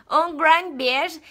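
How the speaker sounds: halting foreign-accent goodbye, "Um grande beijo" stretched; put-on accent. Shown as a demonstration. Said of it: "gostado do meu sotaque".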